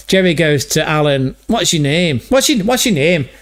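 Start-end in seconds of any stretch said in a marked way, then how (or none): 1.5-3.3 put-on Celtic accent, rising higher; Jerry's Irish accent. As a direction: imitate an Irishman asking, in a higher voice. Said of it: "I think Jerry might have been Irish".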